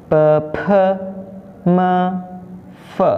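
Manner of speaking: "P, P" as unpuffed then puffed "puh", then "me", "fe"; teacher modelling "P, P, me, fe" one sound at a time; pinyin sound drill. Shown as a demonstration. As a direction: chant "P, P, me, fe" as separate schwa syllables. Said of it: "Seperti itu".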